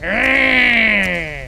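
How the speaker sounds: loud, long drawn-out creature cry; imitated Wisp mother wail; angry monster screech. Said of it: "I feel like that's what she sounds like".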